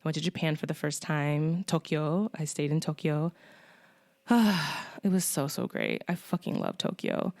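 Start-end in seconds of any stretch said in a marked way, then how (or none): 4.3-5.0 big audible sigh of fondness; blissful sigh. Real, not acted. Traveler remembering Tokyo for listeners, fond sigh. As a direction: let out a long, adoring sigh.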